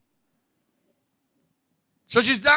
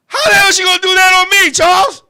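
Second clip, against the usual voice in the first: loud, higher, fast protest; outraged disbelief; 5 semitones higher, 5 dB louder than usual. Acted. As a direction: cry out in comic indignation.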